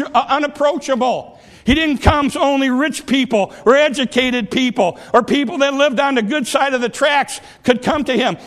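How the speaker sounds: full-force preaching. Real, not acted. Preacher telling the congregation why the master came, full-force preaching.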